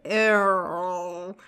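"Eorl" drawn out uncertainly; fumbled pronunciation. Real, not acted. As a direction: stumble through "Eorl" as an unknown name. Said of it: "not knowing how to say".

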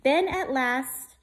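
drawn-out, building suspense; slow suspense reading. A narrator's reading. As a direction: stretch it out for the kids. Reads rhyming picture book.

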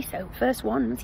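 normal talking voice

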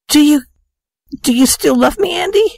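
very high, hesitant weepy question; tearful plea. Acted. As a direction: ask for love through acted tears. Tearful fiancée asks if still loved.

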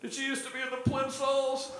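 put-on high girlish question; young girl voice. Girl on the bench asking about the Plimsolls, high girlish voice. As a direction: ask it in a high young girl's voice. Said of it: "She goes".

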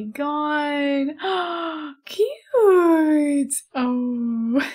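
drawn-out, high-pitched cooing. Real, not acted. Gushing over a cute virtual dog in baby voice. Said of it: "I literally get my, like, baby voice on".

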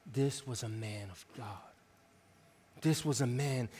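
low, soft retold testimony; hushed tribute. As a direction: quietly voice the mourners' reverence. Retold funeral tribute, spoken reverently.

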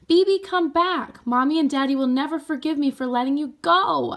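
high, loud desperate call; high loud calling out in panic; screaming. A narrator's reading. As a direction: scream after your brother. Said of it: "I screamed".

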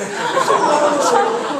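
loud, high burst of laughter; big laugh. Real, not acted. Remembering hanging up on Kennedy, loud laughter. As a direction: let the laugh explode. Laughs after slamming phone.

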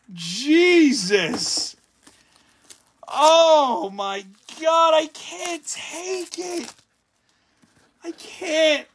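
high, breathless disbelief; barely able to speak; overwhelmed. Real, not acted. Overwhelmed after big pull.

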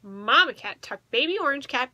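plain reading voice

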